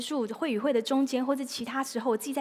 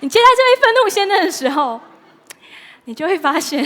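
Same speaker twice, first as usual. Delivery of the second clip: much higher and louder, giggling over the words; laughing; 7 semitones higher, 14 dB louder than usual. Real, not acted. Preacher still giggling while resuming the point, high. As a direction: push through, still laughing. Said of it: "笑完了吗".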